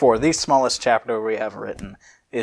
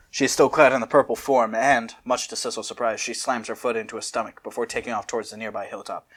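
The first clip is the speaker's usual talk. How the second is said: ordinary talk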